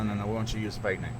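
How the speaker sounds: ordinary delivery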